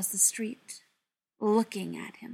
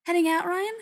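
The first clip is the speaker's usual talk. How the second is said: higher, louder call from afar; calling over; 8 semitones higher, 6 dB louder than usual. A narrator's reading. Shop owner calls to Ryan from the stacks, calling over. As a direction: float the question across the room, raised and lifted. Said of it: "her voice floating over from the stacks".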